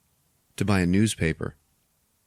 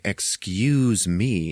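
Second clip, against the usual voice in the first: drawn-out, put-out retold remark; annoyed; much slower than usual. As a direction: stretch it slowly with mock annoyance. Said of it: "she is annoyed".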